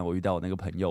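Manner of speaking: usual speaking voice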